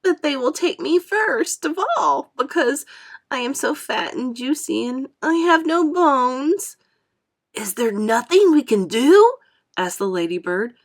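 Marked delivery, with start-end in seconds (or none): 0.0-6.8 much higher frightened earthworm; fearful whimper. A narrator's reading. Earthworm fearing sharks will take him first. 7.5-9.4 louder urgent plea; appealing. A narrator's reading. Ladybird appealing to James for help. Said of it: "appealing to James".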